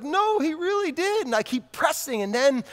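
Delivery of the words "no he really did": put-on child insisting. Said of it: "Alex is like".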